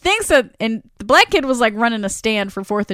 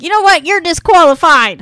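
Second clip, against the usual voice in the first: stern verdict; 6 semitones higher, 7 dB louder than usual. Retold or imitated. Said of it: "And the Reverend's like".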